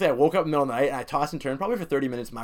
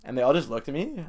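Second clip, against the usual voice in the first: ordinary delivery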